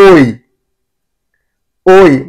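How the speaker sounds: "ఓయ్" as one gliding oy; "ఓయ్" emphatic, o sliding into y; German eu sound. Shown as a demonstration. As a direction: call out "ఓయ్" like the film title. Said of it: "ఈ యు రెండు కలిపి వస్తే".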